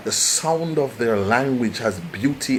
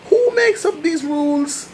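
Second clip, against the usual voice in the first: exasperated rhetorical question. Real, not acted.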